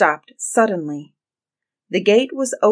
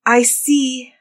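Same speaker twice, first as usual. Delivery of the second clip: high-voiced report from above; sister calling down; 6 semitones higher, 3 dB louder than usual. A narrator's reading. Sister reporting from the tower, calling down. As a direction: call the sighting down in a high voice.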